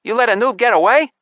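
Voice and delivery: high, loud disbelieving question; incredulous. A narrator's reading. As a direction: demand it as if you can't believe it. Elf officer reacts to escape.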